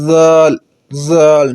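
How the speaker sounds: ذال said softly without hiss; teacher modelling a soft letter; soft dhal. Shown as a demonstration. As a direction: soften ذال. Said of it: "نرم کر کے".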